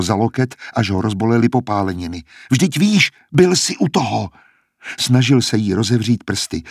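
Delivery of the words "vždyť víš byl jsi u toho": high, agitated insistence; frantic insistence. A narrator's reading. Klemencie grabs his arm and reminds him, frantically insistent. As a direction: press him urgently, pitched up high.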